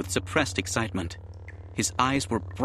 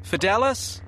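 high, sharp command; 10 semitones higher, 3 dB louder than usual. A narrator's reading. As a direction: snap the name out. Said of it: "sharp and commanding".

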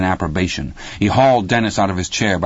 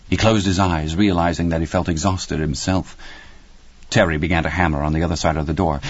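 usual speaking voice

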